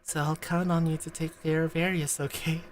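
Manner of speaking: low, soft, earnest character voice; low quiet game dialogue read; reading Sensei's lines. Acted. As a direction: voice the gentle teacher.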